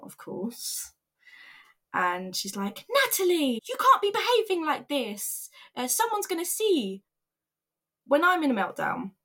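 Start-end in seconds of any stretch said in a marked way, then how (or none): 2.9-7.0 much higher, scolding imitation; embarrassed manager. Manager finding employee crying in the car park, mortified scolding. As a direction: mimic a flustered boss's high-pitched reproach. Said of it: "She was embarrassed of me".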